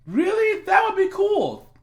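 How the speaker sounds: put-on high-pitched eager girl; voiced young girl.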